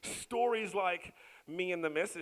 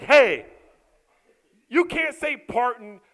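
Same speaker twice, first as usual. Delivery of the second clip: scolding; 10 semitones higher, 17 dB louder than usual. Retold or imitated. Dad scolding son.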